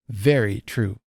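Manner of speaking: a hint of grave, firm emphasis; lightly voiced solemn lady; grave and emphatic. A narrator's reading. Host voicing the silent lady, mildly grave and emphatic. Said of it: "said gravely and emphatically".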